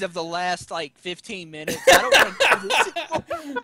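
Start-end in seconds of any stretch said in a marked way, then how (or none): 1.9-3.5 laughing exasperation. Real, not acted. Groaning about the Gambit talk.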